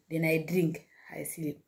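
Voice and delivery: sleepy whispered trailing off. Retold or imitated. Drifting off to sleep in story.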